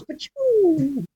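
rocket blast noise. Retold or imitated. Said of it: "a Boba Fett that goes".

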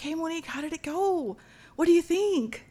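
high-pitched eager questions; super excited. Retold or imitated. Dance mom asking how it went, super excited. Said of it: "she's super excited".

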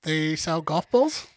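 slow guess with "balls" rising like a question; questioning tune. Real, not acted. Quizzed on what Callaway is, answering "golf balls" as a question. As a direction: lift "balls" at the end, unsure of the answer. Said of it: "Why do you say it as a question?".

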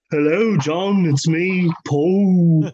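a put-on British accent, lightly done; mock British accent. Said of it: "This is my [name] accent".

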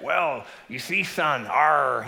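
slow gruff pirate growl. Acted. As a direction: play the old pirate, drawn out. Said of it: "the pirate's like".

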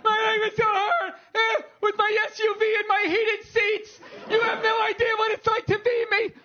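high, loud mocking whine; hammed-up spoiled complaining; whiny complaining woman. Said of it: "so much complaining in my life".

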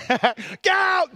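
high, loud comic yell; shouted order. Retold or imitated. Jesus putting the crowd outside, acted shout. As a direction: yell it as a sudden command.

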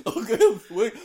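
high goofy dog voice; squeaky, silly sword dog voice; Zacian impression. Still voicing the sword dog.

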